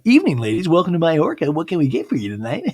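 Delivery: loud, high-pitched waiter greeting; chirpy waiter. Retold or imitated. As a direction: play an over-eager waiter welcoming diners. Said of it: "the waiter's like".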